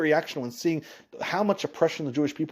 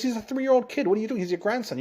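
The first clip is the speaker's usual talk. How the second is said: higher-pitched incredulous protest; urgent objection in a raised pitch; 10 semitones higher, faster than usual. Acted. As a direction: plead the child's case, pitched high. Adviser pleads against killing a child.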